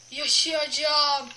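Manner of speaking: slow, loud, stretched out; drawn-out vow. Real, not acted. Gamer vowing slowly and loudly to survive. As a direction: drag every syllable out in grim resolve.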